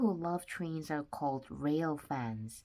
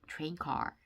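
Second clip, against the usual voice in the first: plain reading voice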